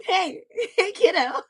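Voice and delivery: light imitation of an annoying teasing voice, laughing; annoying Red Wing voice. Artist imitating the voice actor's annoying Red Wing, laughing. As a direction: tease like a smug older guy, half laughing. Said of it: "she does the annoying".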